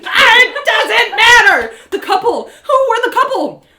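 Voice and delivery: high, very loud, drawn-out outburst; frantic, shouted demand; exasperated shout. Acted. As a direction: yell in total impatience. Exasperation boiling over at innkeep.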